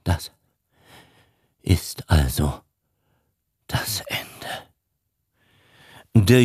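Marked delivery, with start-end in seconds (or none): 0.0-4.3 barely voiced, the words fading to breath; broken whisper. A narrator's reading. Old father whispers his despair to his son, broken. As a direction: let it die away into a whisper.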